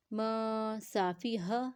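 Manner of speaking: "م" held as a nasal hum before "صافی ہا"; nasal ghunna. Shown as a demonstration. Teacher shows noon before seen, nasal ghunna. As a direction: stretch "م" through the nose into "صافی ہا". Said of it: "ہم نے غنہ کیا ہے".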